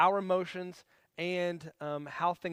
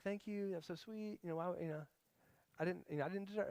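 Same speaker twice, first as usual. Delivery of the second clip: touched, near tears; 11 dB quieter than usual. Retold or imitated. Wife thanking her husband for taking the kids, mock tearful. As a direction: lightly act out being moved, almost too quiet. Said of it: "No, she'd probably cry".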